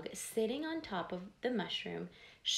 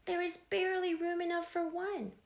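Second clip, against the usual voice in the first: small ant voice; 9 semitones higher than usual. Acted. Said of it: "said the ant".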